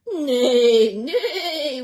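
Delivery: loud laughing neigh; whinnying horse. Acted. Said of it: "whinnied the horse".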